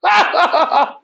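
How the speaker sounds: high-pitched laugh. Real, not acted. Teacher cracks up at Euler's joke, high laugh.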